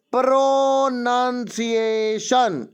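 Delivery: slow, carefully drawn-out प्रोनंसिएशन with a falling end; correct pronunciation. Shown as a demonstration. Teacher models the word for students, slow and careful. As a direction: spell out प्रोनंसिएशन slowly for the class. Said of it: "इसको प्रोनंसिएशन बोलते हैं जो कि सही है".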